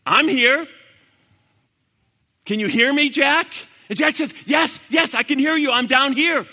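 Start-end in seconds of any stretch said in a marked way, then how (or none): 0.1-3.5 lightly acted booming call; mysterious voice from above. Voice calling down to Jack on the cliff, projected. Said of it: "and the voice says". 4.5-6.5 high, loud eager answer; urgent calling out. Retold or imitated. Jack answering the voice from below, urgent call.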